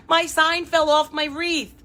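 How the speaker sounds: high, slow whiny customer voice, lightly played; mock upset customer. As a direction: play the complaining customer whose sign fell off. Imitating an upset customer's complaint.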